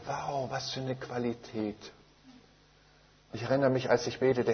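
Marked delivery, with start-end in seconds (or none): 0.0-1.9 hushed, slowed wonder; quiet awe. Retold or imitated. Thinking to himself about the healing, hushed awe. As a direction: breathe it out, amazed. Recalls his own inner amazement.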